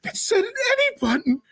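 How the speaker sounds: high, tearful gasp of outrage; whiny distressed cry. Acted. User upset at the game prompt, crying whine. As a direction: wail that it said any button.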